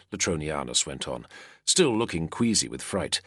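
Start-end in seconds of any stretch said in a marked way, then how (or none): none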